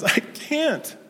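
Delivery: very high, whiny child imitation; upset little boy. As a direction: plead helplessly like a small boy. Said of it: "he's crying and he says".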